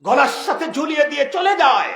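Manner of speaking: high, loud preacher's cry; emphatic shout. Real, not acted. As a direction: proclaim it forcefully to the crowd. Repeats line loudly for emphasis.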